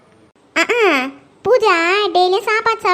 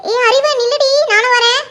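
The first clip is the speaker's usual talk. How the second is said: high, raised urgent call; calling after someone; 5 semitones higher, 4 dB louder than usual. Acted. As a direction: shout for a friend to wait. Calls after departing friend.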